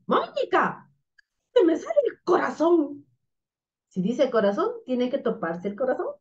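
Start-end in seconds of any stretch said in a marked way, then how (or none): 0.1-3.1 put-on high-pitched startled puppet boy. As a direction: play the frightened puppet, pitched up. Startled puppet boy complains to Mónica. (títere asustado, voz aguda)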